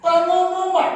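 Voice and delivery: nada tinggi seperti pengumuman; pengumuman biasa. Shown as a demonstration. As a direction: umumkan dengan suara tinggi. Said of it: "Bukan pengumuman, ya". (diucapkan bergaya pengumuman)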